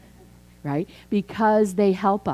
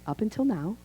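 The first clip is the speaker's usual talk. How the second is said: a light lift in pitch, a bit softer, quoted; quoting a teacher's motto, lightly raised; quoting Mary Mann Morrissey; 5 semitones higher, 3 dB quieter than usual. Retold or imitated. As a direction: lift the catchphrase like a quoted mentor. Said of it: "As Mary Mann Morrissey would say".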